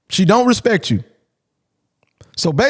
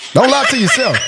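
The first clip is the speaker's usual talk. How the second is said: said through a laugh, pitched higher; cracking up as he repeats it; amused repeat of his own line; 6 semitones higher than usual. Real, not acted.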